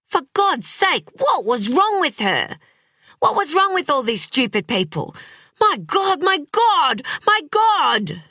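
high-pitched, exasperated outburst; frantic anger. A narrator's reading. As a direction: rage at them in rising panic. Raging at bystanders while girl drowns.

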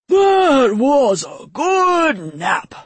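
very slow, high, loud yawny drawl; waking dragon, stretching. A narrator's reading. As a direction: groan it out through a huge waking stretch. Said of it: "and sighed as it stretched".

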